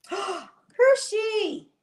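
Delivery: gasp, then high squeal of delight; delighted gasp and greeting. Real, not acted. Greeting Hershey on sight, high delighted squeal. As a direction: gasp and coo the name up high.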